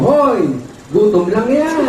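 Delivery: high, dismissive teasing; wife brushes it off. Retold or imitated. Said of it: "ang sabi ko sa akin ng asawa ko".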